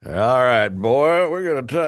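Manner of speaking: loud, high Southern lawman drawl; hammed-up Southern cop pulling someone over; Dukes of Hazzard Southern accent. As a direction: do a big rising Dukes of Hazzard twang. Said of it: "you're gonna get Dukes of Hazzard".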